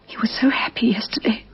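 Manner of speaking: breathy, broken whisper of grief; whispered memory of a dead husband; grieving whisper. Acted. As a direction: barely get the words out through grief. Grieving widow recalls husband.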